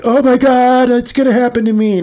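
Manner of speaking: high, loud hammed-up panic; mock panic. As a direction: wail it out in pretend terror. Mock panic against calm reply.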